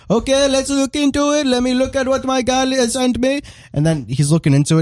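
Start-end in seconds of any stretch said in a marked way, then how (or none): 0.1-3.4 screaming Indian salesman. Retold or imitated. Mocking the salesman who screamed on the phone, in an Indian accent. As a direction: shout the greeting like a pushy salesman, in an Indian accent. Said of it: "He was like".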